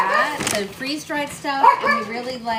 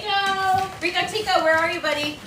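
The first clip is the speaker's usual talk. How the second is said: raised, high call across the room; calling from far away; 7 semitones higher than usual. Real, not acted. Calling dog to kennel.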